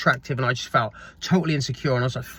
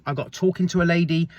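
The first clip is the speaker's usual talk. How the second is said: normal talking voice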